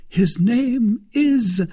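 high, slow and drawn out; Milady, coaxing. A narrator's reading. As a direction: let the question hang. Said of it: "said she in her turn".

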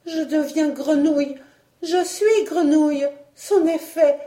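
high, loud lament; despairing cry. A narrator's reading. Marguerite realises she is a frog, despairing cry. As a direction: wail it as all is lost. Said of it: "s'écria-t-elle avec désespoir".

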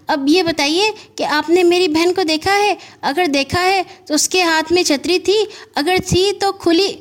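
put-on high, eager little boy asking in a rush; a worried boy firing questions; young boy Gaggu. Anxious boy Gaggu pesters the postman, high and eager.

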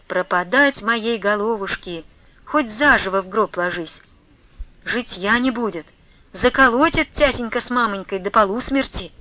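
ordinary delivery

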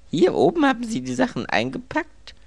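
loud, very high anguished question; appalled, voice shooting up; groaned dismay. A narrator's reading. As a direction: groan it out, aghast. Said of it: "stöhnte Anthony".

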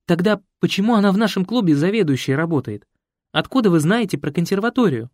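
surprised. A narrator's reading. Young woman astonished question.

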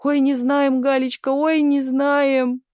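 high, drawn-out keening; wailing lament. A narrator's reading. Aunt to her niece as the truck leaves, wailing. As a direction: lament in a high wail, dragging the vowels. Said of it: "причитала тётя Наталья".

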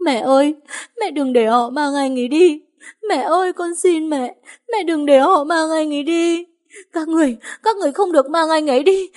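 high, loud tearful begging; crying plea. A narrator's reading. Daughter begging her mother through loud high sobs. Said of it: "hai hàng nước mắt lăn dài van xin".

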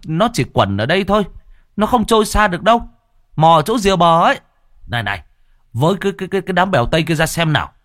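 high, brisk calling-out of orders; militiaman directing searchers. A narrator's reading. Militiaman directing villagers searching at the water's edge, high urgent commands. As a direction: urge the search party on, pitched high and quick. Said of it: "dân quân đang chỉ huy đám người".

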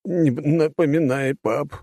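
put-on slow pleading young man; begging son; pained son. A narrator's reading. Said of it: "замотал головой сын".

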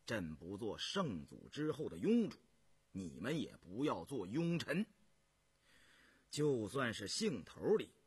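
low, contained imperial voice; stern, resolute emperor. Acted. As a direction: speak low with cold resolve, firming toward the end. Voices Emperor Yongzheng answering ministers.

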